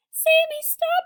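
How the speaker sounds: very high, loud panicked yell. A narrator's reading. Children yelling at Sammy to stop eating, very high and loud. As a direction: scream at him to stop. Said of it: "yelled the children".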